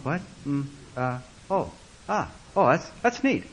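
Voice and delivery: awkward, halting mumbles of acknowledgement; stumbling fillers, soft at first, then forced approval; embarrassed. Retold or imitated. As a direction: act out not knowing what to say. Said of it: "Embarrassed silence".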